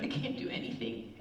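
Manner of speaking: hopeless low murmur. Retold or imitated. Despairing friend quoted.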